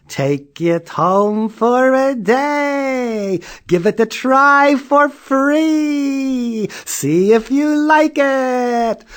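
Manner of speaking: high, slow, sing-song saleslady; sweet shop lady. A narrator's reading. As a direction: coax them kindly and slowly. Store lady offers.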